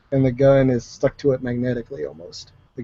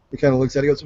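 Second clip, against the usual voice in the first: ordinary talk, nothing marked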